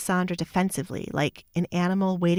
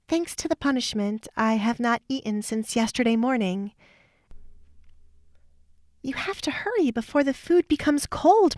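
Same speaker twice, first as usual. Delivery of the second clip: put-on higher, sweetly commanding young woman; higher-voiced Alessandra, sweetly bossy; defiant young woman; 5 semitones higher than usual. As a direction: pleasant on the surface, an order underneath. Alessandra taunts her stepmother.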